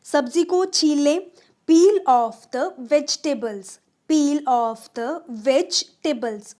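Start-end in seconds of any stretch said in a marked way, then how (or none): none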